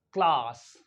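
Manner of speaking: CLASS with a long open 'ah', as in father; British long A. Shown as a demonstration. Teacher demonstrating British English to learners, long-ah CLASS. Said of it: "in British English".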